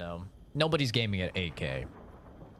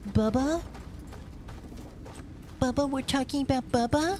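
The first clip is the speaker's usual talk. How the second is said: high, squeaky excited voice; excited Kenzie. Retold or imitated. Imitating Kenzie gasping excitedly about Bubba, high squeal. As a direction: squeal the name like an overexcited kid. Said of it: "Kenzie goes".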